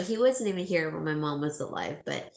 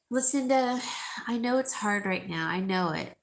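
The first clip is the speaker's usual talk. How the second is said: ordinary talk, nothing marked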